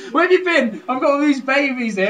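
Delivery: high, loud mock woman's voice; northern housewife. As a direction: nag him as a harassed northern mum. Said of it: "I can't even do it with a woman".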